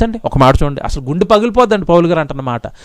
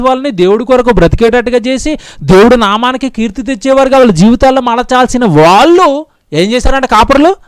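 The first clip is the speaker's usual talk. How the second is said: ordinary delivery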